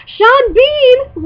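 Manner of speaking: high, loud, long-drawn delighted cry; excited fan. Real, not acted. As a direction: squeal a favorite actor's name, stretched out. Fan spots favorite actor's name.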